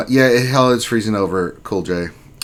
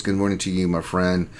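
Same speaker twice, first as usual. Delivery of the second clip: usual speaking voice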